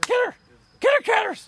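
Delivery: very high, loud cheering at the cat; excited cheer. Real, not acted. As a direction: shout the cat on, thrilled.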